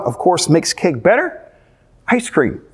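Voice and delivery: ordinary talk, nothing marked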